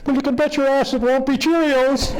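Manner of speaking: put-on very high little-kid voice; tiny kid's high-pitched retort; four-year-old boy. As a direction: squeak it out like a small child. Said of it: "she turned to the four-year-old".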